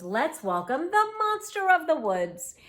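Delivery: high, loud celebratory welcome; beaming. A narrator's reading. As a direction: announce the guest high and proudly. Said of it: "Owl beamed".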